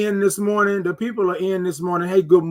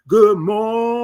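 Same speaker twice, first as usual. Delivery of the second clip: MORNING with a very long held O, pitched up; ringing, higher "morning" sustained like a sung note; drawn-out good morning; 4 semitones higher, 4 dB louder, slower than usual. Shown as a demonstration. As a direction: stretch every sound of morning, O above all. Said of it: "give me all the O's".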